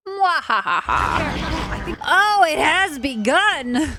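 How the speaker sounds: high, slow hammed-up villain gloat; sneering panto villain. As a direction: cackle and gloat like a panto baddie. Hammed-up villain cackle after game line.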